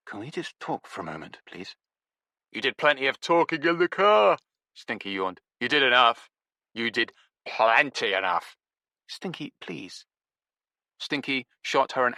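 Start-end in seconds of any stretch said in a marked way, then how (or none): 0.1-1.8 much softer, urgent plea; almost under the breath; lowered voice. Acted. Araminta quietly pleading with Stinky. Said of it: "lowering her voice". 2.5-8.6 dismissive rebuff. Acted. Stinky rebuffing Araminta. 9.1-10.1 much softer, pleading; soft plea. Acted. Araminta pleading with Stinky, soft and meek.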